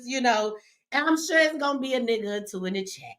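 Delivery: ordinary talk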